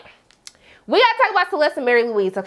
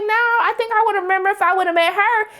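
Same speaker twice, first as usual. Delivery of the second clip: lightly voiced prim older woman, pitched higher; a hint of a sweet, probing society lady; imitating Mary Louise; 9 semitones higher than usual. Recapping the show, she imitates Mary Louise greeting the guest higher. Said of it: "She was like".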